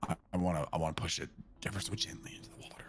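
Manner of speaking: fast hushed whisper; wildlife watching whisper. Acted. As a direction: whisper quickly, careful not to disturb. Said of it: "we gotta whisper".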